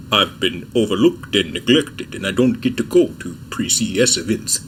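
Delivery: lightly voiced deadpan tech reviewer grumbling about being left out; a hint of a flat, soulless monotone; Vincent Teo impression. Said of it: "my Vincent Tio impression is gonna be pretty trash".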